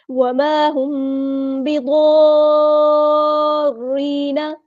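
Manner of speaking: slow melodic chant, drawn out; Quran recitation. Shown as a demonstration. Teacher models the verse for students, chanting. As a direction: intone the verse like a qari.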